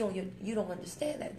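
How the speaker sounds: usual speaking voice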